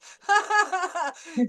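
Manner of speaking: short bright laugh. Real, not acted. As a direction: laugh out loud. Laughs at student.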